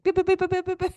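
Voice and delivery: very high beep boop noises; mock keypad beeps. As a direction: chirp out button tones. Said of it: "I'm like".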